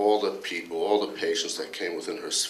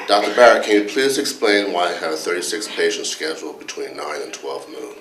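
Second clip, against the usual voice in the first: louder, a touch indignant complaining colleague; Dr. Anderson; 10 dB louder than usual. Retold or imitated. Colleague grilling the department head about hospital rules, mock indignant. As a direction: press the boss with mock exasperation, half-laughing. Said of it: "went something like this".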